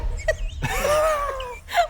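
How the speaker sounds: high squealing laugh-cry from the heat; pained laughing cry. Real, not acted. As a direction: laugh and yelp through the burn. Hot sauce pain, laughing.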